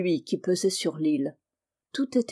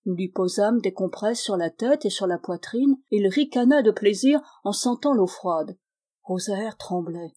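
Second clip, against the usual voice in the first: ordinary delivery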